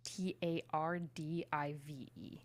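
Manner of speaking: slow, letter by letter; spelling out slowly. Shown as a demonstration.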